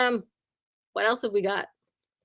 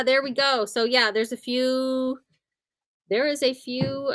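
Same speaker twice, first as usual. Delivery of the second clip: normal talking voice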